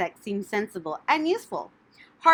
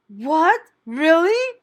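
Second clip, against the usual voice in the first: higher, louder hopeful surprise; cried out; 9 semitones higher, 8 dB louder, much slower than usual. A narrator's reading. Wilbur hearing he won't die, crying out. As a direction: cry out with sudden hope. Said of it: "cried Wilbur".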